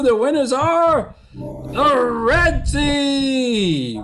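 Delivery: high, stretched-out big reveal; slow game-show announcer climax; winner announcement. Real, not acted. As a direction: draw out the final words triumphantly. Announces the winners after drum roll.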